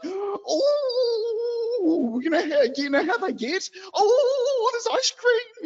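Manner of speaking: put-on high, squealing child's excitement; breathless kiddy delight at ice cream; excited child. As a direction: squeal like a kid who sees ice cream. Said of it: "this little child seemed like it was like".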